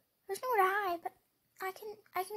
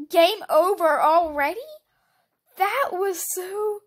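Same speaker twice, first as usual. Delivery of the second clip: loud, slow disbelieving cry; shocked outburst; 9 dB louder, slower than usual. Real, not acted. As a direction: gasp it out in disbelief. Shocked by losing.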